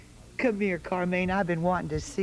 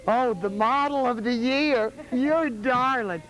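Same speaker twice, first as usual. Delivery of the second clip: cooing; 5 semitones higher, slower than usual. Real, not acted. Cooing over a little girl.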